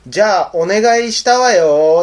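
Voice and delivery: theatrical parting request, stretched at the end; ghost girl's send-off. Retold or imitated. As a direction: call it out slowly as you leave. Ghost girl's breezy parting line.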